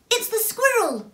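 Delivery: high, loud character cry; alarmed exclamation. A narrator's reading. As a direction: call out the sudden sighting.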